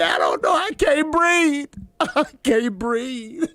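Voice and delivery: hammed-up sobbing, high and slow; fake-wail it breathless and laughing; mock crying. Host mocks sick friend crying in the ambulance. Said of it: "sitting there crying".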